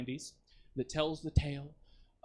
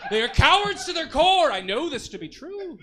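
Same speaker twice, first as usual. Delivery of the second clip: laughing mock outrage; 12 semitones higher, 9 dB louder than usual. High, loud laughing taunt.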